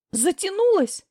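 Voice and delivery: high hysterical echo; near-hysterical. Acted. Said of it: "на грани истерики переспросила".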